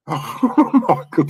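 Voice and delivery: laughing in disbelief; amused despair breaking into laughter; real helpless laughter. Real, not acted. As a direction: laugh through the words. Laughing at the comical play.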